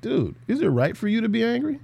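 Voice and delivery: calm God. Acted. God calmly asking the angry Jonah, soft and easy. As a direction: gently ask like a laid-back friend. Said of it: "the Lord is very calm with Jonah".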